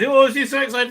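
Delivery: mock the family's excitement; mock excited gushing. Mocking the family's reaction, high-pitched gush.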